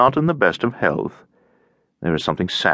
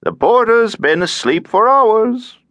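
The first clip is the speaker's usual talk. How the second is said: slow, higher-pitched drawn-out voice; droning; 4 dB louder than usual. A narrator's reading. Alfyorov tells Ganin banging is useless, droning. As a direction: drone on slowly in a raised voice. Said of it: "droned Alfyorov's voice".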